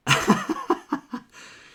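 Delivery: a sudden, loud, high laugh that breaks out; high burst of laughter. Real, not acted. Streamer to chat, laughing at Jelly's joke, loud high laugh. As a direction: crack up loudly at the chat.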